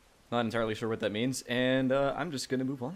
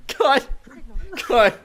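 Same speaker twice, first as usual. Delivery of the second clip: shouting cut while laughing; 12 dB louder than usual. Real, not acted. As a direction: yell it through giggles. Laughing breakdown mid intro.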